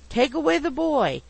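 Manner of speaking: high, loud, testy order; pettish. A narrator's reading. Pickwick wants the screaming boy removed, pettish. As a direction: demand it in exasperation. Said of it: "replied Mister Pickwick pettishly".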